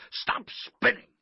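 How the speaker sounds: loud coach's command; forceful sideline bellow; shouted. A narrator's reading. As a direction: order a player over at a yell. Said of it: "Coach shouted".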